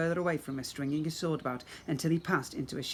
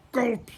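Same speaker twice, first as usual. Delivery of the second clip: gulp sound; 8 semitones higher, 6 dB louder than usual. Acted. Monster swallows prince.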